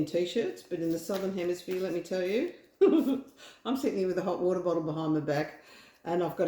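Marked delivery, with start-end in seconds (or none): 2.8-3.6 laugh. Real, not acted. Joking about the cold night.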